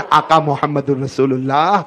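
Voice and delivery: normal talking voice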